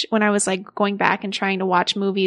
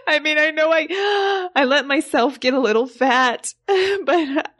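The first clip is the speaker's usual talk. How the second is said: higher, shocked, fretful wife on the phone; Angie on the phone; 7 semitones higher than usual. Acted. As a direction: play the frantic wife who just saw him on TV. S1 plays Angie.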